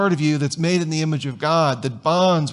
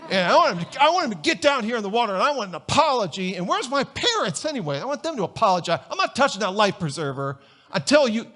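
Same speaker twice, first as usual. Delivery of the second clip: hammed-up teen demands, pitched up and hurried in bursts; indignant teenager; 5 semitones higher, faster than usual. Stubborn teenager in the water refusing the life preserver, mock indignant. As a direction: rattle off demands like an entitled teenager.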